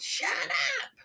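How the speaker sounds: very high, squeaky little mouse voice; high-pitched mock snarl. A narrator's reading. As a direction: snap it as a cross, tiny mouse. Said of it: "Mouse snarled".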